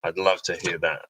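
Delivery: ordinary talk, nothing marked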